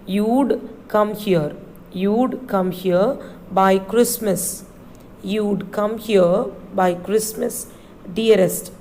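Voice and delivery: "You'd come here" said slowly, word by word; slow model reading. Shown as a demonstration. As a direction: read it slowly for the class to copy. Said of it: "You should pronounce it as you'd".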